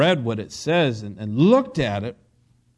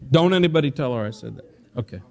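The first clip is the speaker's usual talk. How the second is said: ordinary delivery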